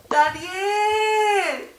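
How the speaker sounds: high, slow mournful call into the pit; a king calling sadly into the lions' den. Acted. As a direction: cry out his name in sorrow, drawn out. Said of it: "gritarle tristemente".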